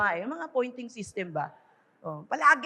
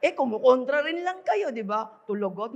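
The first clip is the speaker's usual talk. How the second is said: normal talking voice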